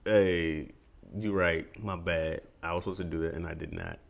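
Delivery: quiet, fading mock-sorry; sarcastic apology. Boyfriend apologizing to angry girlfriend, quiet sarcastic. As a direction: say sorry without meaning it. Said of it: "The way you're saying it sounds very sarcastic".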